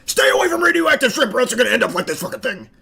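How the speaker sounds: fast, mock-dramatic warning to little kids; hammed-up scare talk for toddlers; quick mock scolding of toddlers; scare speech to toddlers. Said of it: "if he was giving this speech to, like, an audience of toddlers".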